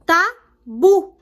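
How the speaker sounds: "Tabu" in two separate beats, BU stressed. Shown as a demonstration. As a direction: split "Tabu" into TA, then BU. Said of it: "o bu é a sílaba tônica". ("Tabu" em duas sílabas separadas, tônica no BU)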